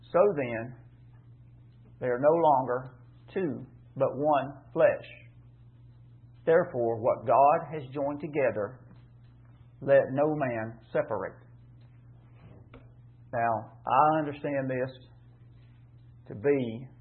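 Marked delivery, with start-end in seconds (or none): 0.1-11.3 slow and louder, weighing each phrase of the verse; solemn scripture reading. Acted. Preacher reading the verse to his church, slow and solemn. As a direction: read it out like holy writ to the congregation. Reads verse six aloud.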